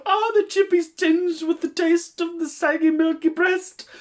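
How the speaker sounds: high, loud mock wailing; overacted grief, voice cracking; hammy wailing. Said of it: "I'm gonna read aloud".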